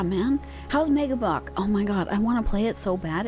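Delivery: usual speaking voice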